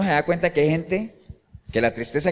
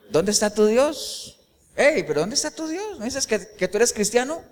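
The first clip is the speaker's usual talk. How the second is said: mocking taunt; 5 semitones higher, 4 dB quieter than usual. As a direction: needle the believer with a lightly voiced sneer. Unbeliever mocking the believer.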